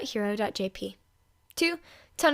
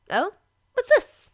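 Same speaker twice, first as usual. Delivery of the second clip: curious surprise; 10 semitones higher, 6 dB louder, slower than usual. A narrator's reading. Curious discovery.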